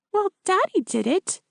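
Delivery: put-on high, plain child's voice; innocent little girl. A narrator's reading. Child talking.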